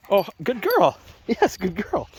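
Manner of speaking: high-pitched praise to a dog. Real, not acted. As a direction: gush over the good dog in a high voice. Praising dog after recall.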